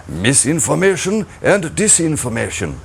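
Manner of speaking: the two buzzwords in a hammed-up German accent; mock German accent, like a stern official reading out regime jargon. Mocking regime jargon for viewers in a German accent. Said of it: "I have to say it in a German accent".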